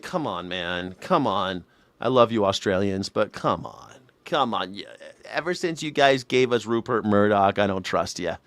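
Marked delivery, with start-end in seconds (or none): none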